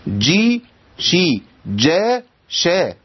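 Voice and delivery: جي then شي, جا then شا, slowly, each sound marked apart; slow paired syllables جي شي جا شا; j versus sh contrast. Shown as a demonstration. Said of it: "ما ينفعش اقول شا".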